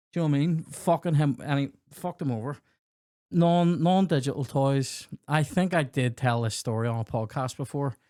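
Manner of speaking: ordinary talk, nothing marked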